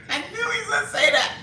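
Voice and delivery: high, fast, loud laughing words; laughing loudly. Real, not acted. Host laughing at a viewer's expected comment, high and fast. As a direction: laugh it out, called it.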